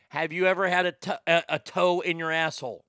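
usual speaking voice

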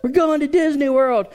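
put-on very high-pitched chanting child voice; squeaky mock-excited children; excited kids, imitated. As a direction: mimic kids thrilled about a trip. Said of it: "They were all excited about Disney World".

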